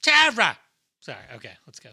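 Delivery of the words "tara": high, very loud mock cry; hammed-up botched American accent shout; Charlie Hunnam. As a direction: yelp it in a bad American accent. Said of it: "Charlie Hunnam".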